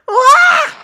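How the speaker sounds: high acted scream of fright; terrified scream. Victim seeing a ghost, high terrified scream. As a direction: yelp in terror, very high.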